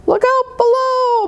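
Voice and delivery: high, thrilled yell; excited scream. Acted. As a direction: shout it flying down the hill. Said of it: "Molly Lou Melon screamed".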